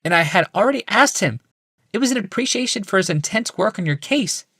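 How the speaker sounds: a polite wife, lifted and high; Rebecca. A narrator's reading. Wife greeting her husband, high woman's voice.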